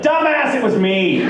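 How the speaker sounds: imitating an exasperated friend, punched high at first; exasperated confession. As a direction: burst out with the mock-scornful reveal.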